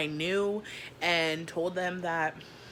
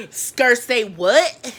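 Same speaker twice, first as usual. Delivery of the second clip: slow, loud, incredulous yell; outraged shout; 6 semitones higher, 13 dB louder, much slower than usual. Retold or imitated. Told her check is held, she yells back slowly. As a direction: drag out each word in disbelief. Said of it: "And I was like".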